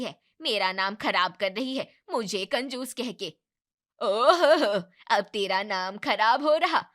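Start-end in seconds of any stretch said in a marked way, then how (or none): 4.0-6.8 mocking ohho. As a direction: scoff at her out loud. Neighbour mocking mother-in-law's complaint.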